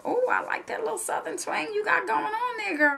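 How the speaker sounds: high, soft, admiring gush; admiring customer. Retold or imitated. Customer gushing over her Southern twang, high and soft. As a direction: coo it like a delighted customer. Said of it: "they were like".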